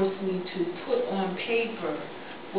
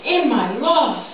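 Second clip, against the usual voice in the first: loud, raised dramatic declaiming with falling phrase ends; impassioned poem recital; 7 semitones higher, 9 dB louder than usual. Acted. Parent remembers a child lost at Sandy Hook, impassioned recital. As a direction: recite it with grief, pressing hard then dropping away.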